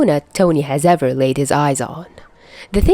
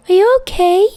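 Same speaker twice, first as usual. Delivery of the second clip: put-on high, loud small child; little Morgan checking on Peter, high child voice; little girl voice; 5 dB louder, faster than usual. A narrator's reading. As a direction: ask with big eyes.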